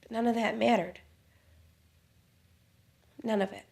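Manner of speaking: soft, with long pauses; tender, hushed recollection. Acted. Wife remembering marrying the man she loved, hushed. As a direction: let it sink to a near hush.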